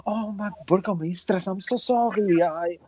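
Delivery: high, fawning apology; Claudia Velasco. Acted. Woman bows to the burgomistress, high apologetic voice. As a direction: grovel in a high character voice. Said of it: "she kind of bows".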